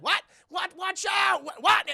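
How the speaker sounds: high, loud, rapid-fire alarm; hammed-up confused shouting; mock panicked exchange. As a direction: bat the warning back and forth, yelling. Voices panicked back-and-forth warning.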